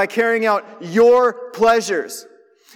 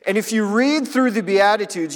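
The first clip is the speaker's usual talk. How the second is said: ordinary talk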